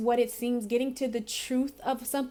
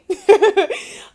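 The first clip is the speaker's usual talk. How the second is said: giggle; 8 semitones higher, 12 dB louder than usual. Real, not acted.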